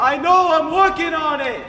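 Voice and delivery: exasperated comeback to nagging; frustrated. Acted. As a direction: answer with rising irritation. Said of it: "Don't sound so frustrated".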